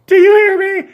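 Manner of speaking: very high, loud shriek; shrill scream. Acted. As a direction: screech across the yard. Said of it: "she continues to scream".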